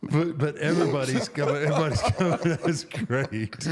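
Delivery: cracking up at his cohost's joke; laughing. Real, not acted. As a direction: lose it mid-sentence.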